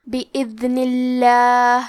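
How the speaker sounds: بِإِذْنِ اللَّهِ with a clear ذ and a thin, light ل in اللَّهِ; بِإِذْنِ اللَّهِ, light lam, slightly slow. Shown as a demonstration. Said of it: "لام کو پتلی آواز میں پڑھنا ہے". (بِإِذْنِ اللَّهِ میں لام پتلی آواز میں)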